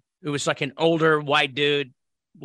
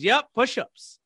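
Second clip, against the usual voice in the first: "Yep, push-ups" high, then falling; higher-pitched "Yep" with a final fall; retold firm confirmation; 8 semitones higher, slower than usual. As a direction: confirm the order, imitating the instructor. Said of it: "and he goes".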